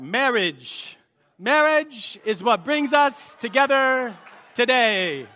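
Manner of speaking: very slow, loud, high put-on voice; hammed-up wedding officiant impression; Princess Bride quote. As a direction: draw out every word like a pompous comic officiant. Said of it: "Princess Bride".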